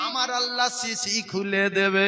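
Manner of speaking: 2 loud, slow, chanted sermon climax; 3 ring it out over the crowd, drawing out each word; 1 fiery preaching. Real, not acted. 4 preacher pleading with the young men, loud and slow.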